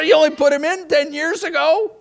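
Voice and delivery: high, loud mock exasperation; husband protests new bedroom, exasperated. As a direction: complain to wife, hammed-up.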